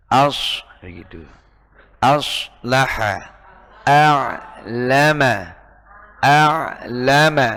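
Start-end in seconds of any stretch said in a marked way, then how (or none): 2.0-7.5 very slow a'lama with plain a; slow correct a'lama. Shown as a demonstration. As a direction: model these slowly. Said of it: "bukan a'lama". (dibaca pelan dan benar)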